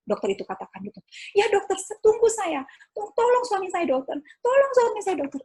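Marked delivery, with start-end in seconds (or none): 1.3-5.4 pleading. Retold or imitated. Begging doctor on phone retold.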